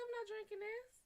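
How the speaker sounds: high, squeaky flat refusal; disgusted refusal. Retold or imitated. To her boyfriend over the ruined coffee, high squeaky refusal.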